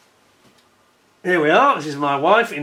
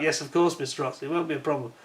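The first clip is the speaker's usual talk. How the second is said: mock polite reply; 8 dB quieter, faster than usual. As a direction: softly read out, a touch of fake politeness. Email reply read softly.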